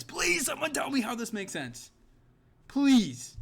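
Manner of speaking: exasperated pleading. Real, not acted. Begging for the matchup to make sense.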